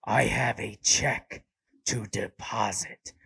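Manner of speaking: loud, slow rasping Batman voice; scaring the teller with a Batman voice. Pretend. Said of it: "Would she be scared?".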